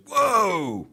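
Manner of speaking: loud, high "Whoa!" then an exaggerated gripe; hammed-up grossed-out waiter; mock disgusted waiter. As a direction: play a waiter recoiling from the smell. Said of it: "Waiter comes over and goes".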